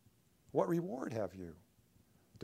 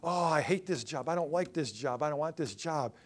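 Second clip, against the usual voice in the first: loud whining grumble; complaining; 3 semitones higher, 5 dB louder than usual. Retold or imitated. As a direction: moan loudly about your lot. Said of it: "I can remember complaining about that".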